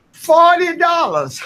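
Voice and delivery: drawn-out indignant exclaim. Real, not acted. Tenant at a public meeting protesting the missing flower money, indignant slow exclaim.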